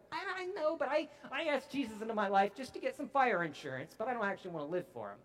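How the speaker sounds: hammed-up excuse, tumbling out fast; excuse-making churchgoer. Churchgoer admitting he wants only fire insurance, mock sheepish.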